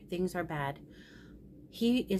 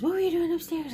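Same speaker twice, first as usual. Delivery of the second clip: high, loud imitated questioning; retold angry demand; 11 semitones higher, 6 dB louder, faster than usual. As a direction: imitate a panicked son demanding an answer. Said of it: "So he's mad".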